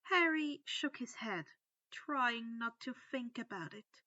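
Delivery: ordinary delivery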